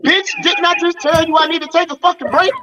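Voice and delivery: high-pitched, exasperated retort; irritated. Acted. Acted reply to a counselor who won't pause, irritated. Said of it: "that irritates you".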